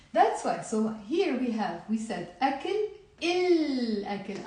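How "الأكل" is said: L of الأكل sounded and held; pronounced lam. Shown as a demonstration. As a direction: say الأكل with a clear, lengthened L. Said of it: "I am pronouncing the ل".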